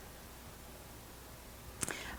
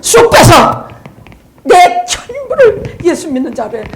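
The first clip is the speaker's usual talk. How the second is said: loud burst of preaching fervor; fervent exhortation; 7 semitones higher, 10 dB louder, slower than usual. Real, not acted. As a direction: cry it out to the congregation. Preacher urging congregation at climax. (뜨겁게 외치는 설교)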